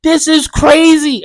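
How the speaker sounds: distraught outburst. Retold or imitated. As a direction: demand answers while sobbing. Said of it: "just bawling, crying".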